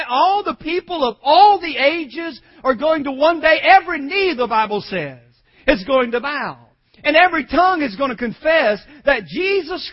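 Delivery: ringing preacher's declaration; full-force preaching. Real, not acted. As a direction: drive each phrase home forcefully.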